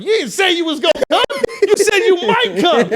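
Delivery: high, fast, laughing objection; laughing protest. Retold or imitated. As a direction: protest through laughter, pitch way up. Arguing with Drake, retold.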